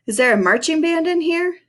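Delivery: high-pitched call across the shop. A narrator's reading. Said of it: "Henry called".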